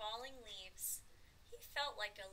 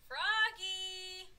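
high, very loud, drawn-out shout; reading aloud loudly; 10 semitones higher, 11 dB louder than usual. A narrator's reading. Froggy reads his own name tag, shouting it. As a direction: proudly belt out the word. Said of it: "He read it aloud, louder and louder".

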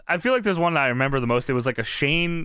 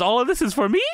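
higher, eager mock disbelief; fired-up Monty; 8 semitones higher, faster than usual. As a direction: play a pumped wrestler thrilled by the gift. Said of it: "He's fired up".

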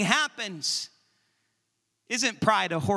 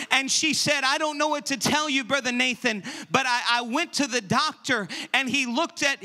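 loud, high, driving sermon voice; loud fervent preacher, pushing every phrase; full-force preaching; 5 semitones higher, 4 dB louder than usual. Acted.

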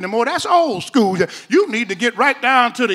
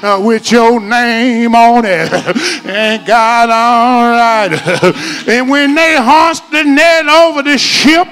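preaching at full force; 5 dB louder, slower than usual. Acted. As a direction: climactic hooping preacher, loud and sung-out.

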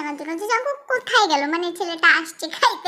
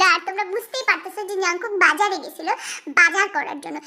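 usual speaking voice

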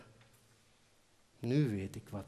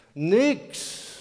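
higher, louder shouted niks; called out; 8 semitones higher, 10 dB louder than usual. Acted. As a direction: snap it down from the top. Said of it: "riep Benjamin". (luid en hoog geroepen)

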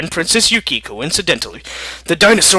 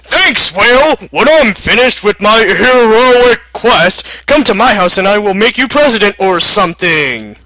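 higher, louder cheerful call; shouted thanks; 4 semitones higher, 4 dB louder than usual. Acted. America thanking the whale as it leaves, shouted. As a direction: yell thanks after a departing friend.

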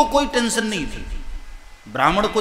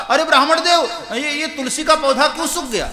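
higher and louder, teasing with a laugh; loud mocking taunt; 7 semitones higher, 3 dB louder than usual. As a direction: needle the poor priest with fake concern. Neighbour mocks with fake concern. (पड़ोसी का ऊँचा, ज़ोरदार और हँसी भरा ताना)

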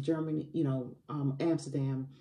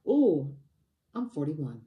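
sudden realization; 7 dB louder, slower than usual. Real, not acted. Coach realizes she misstated her own age, surprised. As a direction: catch yourself mid-thought.

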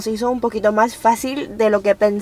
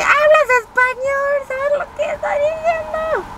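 excited disbelief. Retold or imitated. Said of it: "fue de que".

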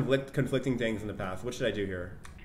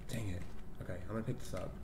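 hushed, quieter, hiding in a closet; whispering while hiding; 6 semitones lower, 13 dB quieter, faster than usual. Real, not acted. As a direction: keep it low so she won't hear. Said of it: "I'm hiding in the closet".

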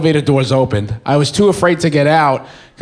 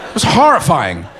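ordinary talk

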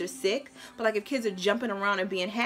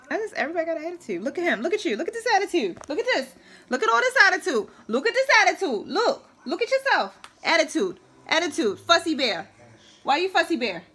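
playful mock scolding; 7 semitones higher, 4 dB louder than usual. Real, not acted. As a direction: tease the little one about his attitude, sing-song. Mom teasing fussy toddler.

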